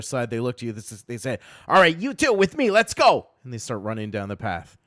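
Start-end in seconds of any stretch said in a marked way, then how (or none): 1.7-3.3 goblin leader. Acted. Goblins order the party.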